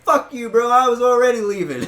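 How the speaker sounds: loud, high angry retort; telling off the owner while leaving; yelling. Retold or imitated. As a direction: spit it back as you storm out. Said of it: "I started fucking yelling".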